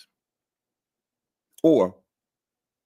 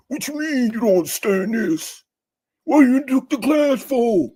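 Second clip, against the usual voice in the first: mock scolding professor, much higher and louder; scornful instructor; 12 semitones higher, 6 dB louder than usual. Instructor embarrassing a student in class, shrill mock scorn. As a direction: scoff at the student like an impatient professor.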